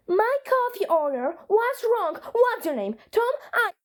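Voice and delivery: high, shrill-pitched complaining customer with a laugh; entitled Karen. Acted. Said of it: "a Karen".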